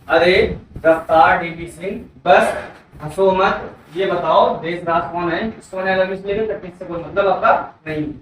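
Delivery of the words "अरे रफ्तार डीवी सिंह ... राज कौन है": slow mnemonic recital. Shown as a demonstration. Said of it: "तो ठीक क्या होंगे?".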